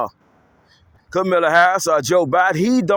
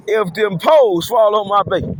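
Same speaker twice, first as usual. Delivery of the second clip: ordinary talk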